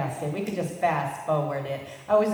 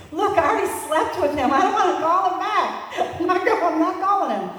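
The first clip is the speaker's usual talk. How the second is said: laughing protest; 12 semitones higher, 6 dB louder than usual. Retold or imitated. Defiant teen to her dad.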